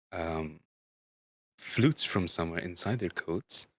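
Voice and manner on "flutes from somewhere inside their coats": usual speaking voice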